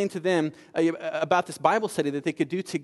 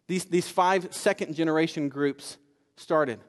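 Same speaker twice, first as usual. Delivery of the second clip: ordinary talk, nothing marked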